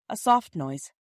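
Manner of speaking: ordinary delivery, nothing marked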